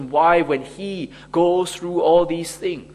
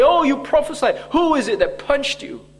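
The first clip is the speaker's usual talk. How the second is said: high-pitched taunt, imitating tormentors; mocking; 9 semitones higher than usual. Tormentors taunt the beaten Jesus to prophesy, mocking. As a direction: jeer it well above your usual pitch. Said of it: "they mocked him and say".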